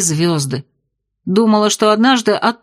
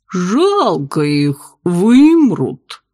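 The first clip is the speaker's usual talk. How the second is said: gurgling tentacle alien; 2 semitones lower, much slower than usual. Acted. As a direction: stretch it out, otherworldly. Octopus bartender speaks.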